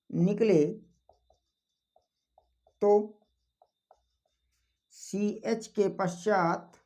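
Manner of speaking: word-by-word dictation with long pauses; slow dictation. Acted. Teacher giving students the exception rule, slow dictation. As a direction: dictate slowly, leaving time to write.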